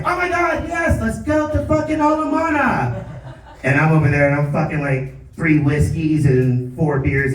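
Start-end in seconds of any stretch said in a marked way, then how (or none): 0.1-3.0 put-on much higher squealing girly voice; excited valley girl. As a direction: gush like an eager valley girl. Coworker Elsa excited about hiking.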